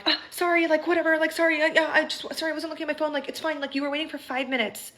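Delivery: high, flustered mock apology; discombobulated. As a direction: imitate a scattered excuse-maker. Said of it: "discombobulated and, like".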